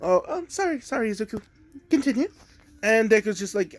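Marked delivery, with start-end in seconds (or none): 0.0-2.3 flustered mom. Retold or imitated. Mom snapping out of thought.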